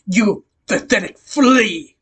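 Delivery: slow, stretched-out insult; acted villain's slow scorn; villain contempt. A narrator's reading. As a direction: spit the insult word by word.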